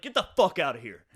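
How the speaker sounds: loud retold blow-up, punched on FUCK; loud, forceful dismissal; angry outburst. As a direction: throw him out at full volume. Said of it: "finger right in the face".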